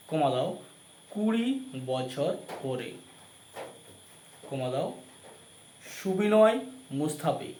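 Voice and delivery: slow dictation for note-taking; dictating. Shown as a demonstration. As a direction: read each title out slowly, pausing. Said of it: "খাতায় লেখো".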